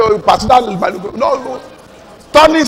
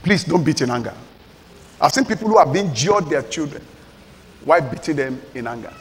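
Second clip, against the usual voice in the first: softer, lower, sincere plea; soft earnest plea; 6 semitones lower, 6 dB quieter, faster than usual. Real, not acted. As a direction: plead quietly with the parents. Preacher pleads gently.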